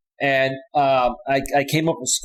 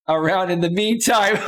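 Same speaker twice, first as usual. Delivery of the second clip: breaking into laughter while reading aloud; laughing; 6 semitones higher than usual. Real, not acted. Streamer reading a crude chat comment, laughing. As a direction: read it out and crack up at the end.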